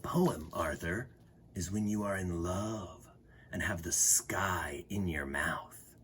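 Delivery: soft, slow, hushed lover's whisper; dreamy whisper. Acted. Lolo dreamily telling Arthur what a poem is, whispered. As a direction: breathe it like someone in love.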